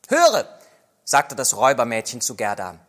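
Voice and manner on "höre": high, loud hey-listen; robber girl calling. Acted. Robber girl calls Gerda to listen, high and loud. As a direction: grab her attention.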